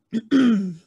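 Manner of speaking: sharp throat clear from allergies. Real, not acted. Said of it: "my allergies are like, no bueno".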